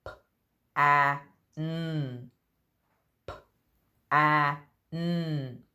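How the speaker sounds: P-a-n, each sound stretched and separate. Shown as a demonstration. Teacher sounding out a word for children to guess. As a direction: sound out every letter slowly. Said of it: "I'm going to sound out the words".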